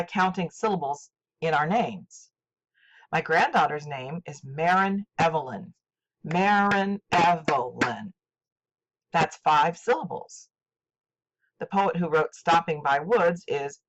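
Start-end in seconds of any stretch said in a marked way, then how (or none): 6.2-8.1 slow, measured MAR-IN EV-E-LYN, each syllable distinct. Shown as a demonstration. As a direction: sound out "Marin Evelyn" slowly, beat by beat. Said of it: "clapping my hands along with each different syllable".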